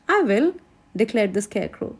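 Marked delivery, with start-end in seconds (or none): 0.1-0.5 higher, eager volunteer; quick and keen. A narrator's reading. Scarecrow volunteering to go first, higher and eager.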